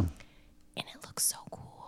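hushed, low whisper. Real, not acted. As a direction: whisper it in awe. Whispered aside.

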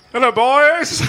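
high, sing-out hello; cheeky greeting. Acted. Player greeting two guards at the door, high playful hello. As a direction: pitch it right up, playfully.

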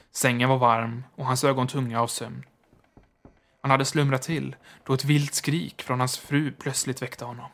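usual speaking voice

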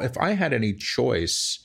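usual speaking voice

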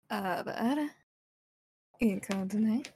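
lightly put-on sleepy anime voice. Shown as a demonstration. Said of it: "think about what my sleepy voice sounds like".

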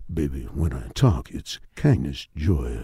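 low smooth radio DJ. Shown as a demonstration. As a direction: croon it low like a late-night DJ. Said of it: "that's my D J voice".

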